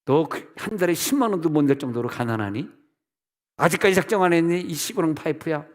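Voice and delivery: sharp reproachful questioning; mock scolding. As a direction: imitate God rebuking a stingy believer. Voices God scolding about the pledge.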